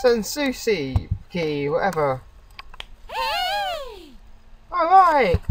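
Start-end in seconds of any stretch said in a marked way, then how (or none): 3.1-5.4 very high put-on girl's voice; high-pitched girlish exclamation; girlish shout. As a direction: call out like an eager schoolgirl. Girl character voiced.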